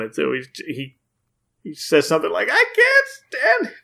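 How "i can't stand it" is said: imitating a villain dying of laughter; very high, hysterical cartoon squeal; Joker. Podcaster imitating a villain mid-laugh, high mock hysteria. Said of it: "it sounds like he's dying of laughter".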